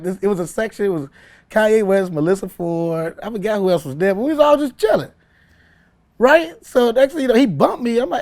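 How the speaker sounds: ordinary delivery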